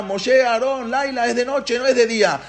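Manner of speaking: high, insistent imitated plea; calling out. Paró calling Moshe and Aarón by night, high pleading call. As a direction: call out and beg, pitched high. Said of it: "Y lo llamaba".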